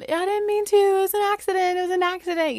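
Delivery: put-on high-pitched pleading kid; mock a kid wailing excuses from the background; imitated child yelling. Oldest sister yelling from background, high imitated pleading. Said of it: "is in the background yelling".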